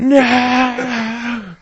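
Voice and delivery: very high, loud drawn-out wail; mock horrified scream. Reacting to a swapped water bottle, mock horrified scream. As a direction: scream it in hammed-up horror.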